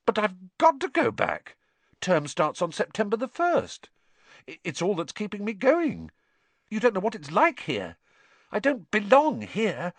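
high-pitched, pleading objection; distressed protest. A narrator's reading. Harry insisting he must return to Hogwarts, distressed protest.

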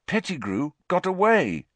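high, aggrieved bitter outburst; Harry, bitter. A narrator's reading. Harry laments the escape, bitterly. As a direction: lift the pitch in bitter frustration. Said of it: "said Harry bitterly".

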